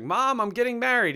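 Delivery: high, excited lightly voiced Michael; imitating Michael Scott. Michael Scott calls his mom.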